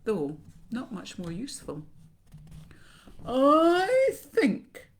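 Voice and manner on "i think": loud, high, long-drawn words through clenched teeth; toothy crocodile; crocodile talking between its teeth. A narrator's reading. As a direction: speak through shut teeth, stretching the vowel. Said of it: "he said it between his teeth like this".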